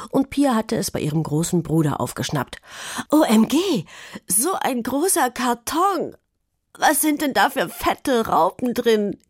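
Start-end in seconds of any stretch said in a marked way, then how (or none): 3.1-9.2 put-on squealing child; excited. A narrator's reading. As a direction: gush over the big box. Pia excited over the box.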